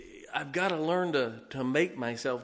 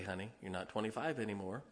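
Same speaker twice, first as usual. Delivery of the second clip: imitating his wife, softer and quick; a gentle, teasing reminder, much softer; sweet wife quote; 4 semitones lower, 11 dB quieter, faster than usual. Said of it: "she'll say".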